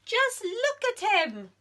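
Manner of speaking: high, dismissive chorus; bees scoffing. Acted. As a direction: brush off her worry. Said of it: "they said".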